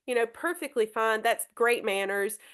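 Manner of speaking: ordinary talk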